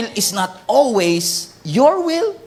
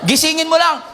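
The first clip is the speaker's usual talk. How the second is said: fiery exhortation; 5 semitones higher than usual. Real, not acted. Preacher shouting at the congregation to wake neighbours. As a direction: wake them up with force.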